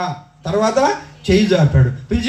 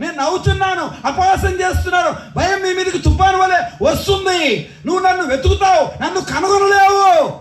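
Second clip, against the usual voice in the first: thundering preaching; 13 semitones higher than usual. Acted. Preacher voicing God's warning to scoffers, thundering. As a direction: hurl God's warning at them.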